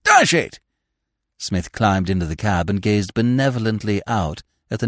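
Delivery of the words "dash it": high, indignant shouts; outraged young man yelling after someone; calling loudly. A narrator's reading. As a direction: hail him urgently from behind. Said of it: "who called loudly".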